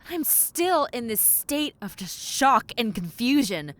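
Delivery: normal talking voice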